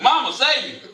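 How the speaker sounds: laughing fake cry for mama; mock plea. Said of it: "what's another one".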